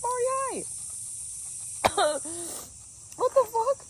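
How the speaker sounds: squeaky high exclamations; high startled calls. Real, not acted. Hiker calling out to her dog, high and startled. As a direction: pitch way up in surprise.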